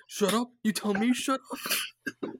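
mock-offended Don Lino; offended mob boss. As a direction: drag out the outrage like an insulted godfather. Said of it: "He's like".

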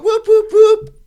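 very high, loud whoops; imitated party whoops. Mimicking a friend dancing at the bar, high party whoops. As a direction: whoop it up in a squealing high pitch.

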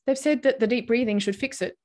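ordinary talk, nothing marked

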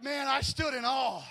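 slow, loud, high cry of amazement; awed shout. Retold or imitated. To the singer, a loud slow cry of awe. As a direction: belt it out like you're stunned by the singer.

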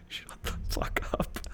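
whispered through laughter; laughing whisper. Real, not acted. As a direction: hiss it out while cracking up. Laughing, telling co-host off.

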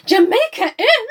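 high, loud, slowed disbelief stretched on Jamaica Inn; shocked cry. Retold or imitated. Coachman startled by Mary's destination, crying out in shock. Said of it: "He cries in shock".

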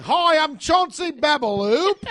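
high, loud, slow self-introduction; hammed-up star with a made-up name; British pop star. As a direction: announce yourself as a silly celebrity. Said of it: "The biggest pop star in Great Britain".

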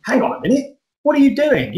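imagined objection, voiced high and puzzled; mock disbelief. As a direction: play a listener pulled up short, pitch jumping. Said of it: "you think".

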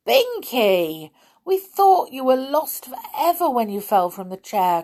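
high, loud, relieved outburst; joyful cry. A narrator's reading. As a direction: cry out to a friend found safe. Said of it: "cried Molly".

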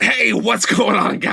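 high, slow gruff greeting; Danny DeVito impression. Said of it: "just Danny DeVito".

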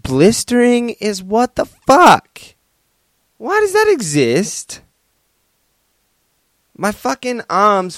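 high, loud, slow disbelieving outburst; exasperated rant. Real, not acted. Complaining to listeners about blisters, high and loud. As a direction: vent at the absurdity of blisters.

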